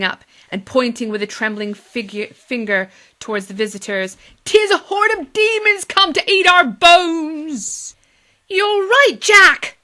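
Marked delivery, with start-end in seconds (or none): 4.4-7.9 loud, high and slow panicked cry; panicked warning. Acted. Villager warning the menfolk about demons, loud panic. 8.5-9.8 loud, high panicked shriek. Acted. Second villager backing up Jack, loud high shriek. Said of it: "shrieked another".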